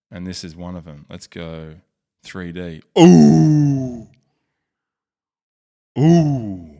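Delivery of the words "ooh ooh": loud, much higher admiring cry of ooh; impressed ooh. Real, not acted. Vlogger seeing the big ship in 3D, a loud awed ooh.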